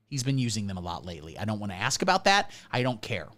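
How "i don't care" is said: ordinary talk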